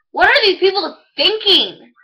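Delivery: high, loud theatrical disbelief; exasperated outburst. Acted. Monologue character despairing at people in the news, exasperated. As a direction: cry it out in acted exasperation.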